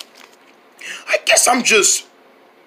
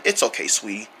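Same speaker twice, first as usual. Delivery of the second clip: soft forgiving wife; 9 semitones lower, 8 dB quieter than usual. Acted.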